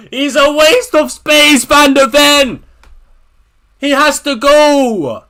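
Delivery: loud, slowing disgusted yell; real loud frustration; angry shout. Real, not acted. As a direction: demand he goes, shouting. Shouts about the player Van de Ven.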